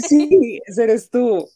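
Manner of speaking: very high delighted squeal; match her amazement, pitched right up; excited confirmation. Real, not acted. Host confirming it is her cover, squealing excitedly.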